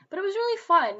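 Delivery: bright burst of enthusiasm; suddenly upbeat and loud; excited. Real, not acted. Upbeat after hardship.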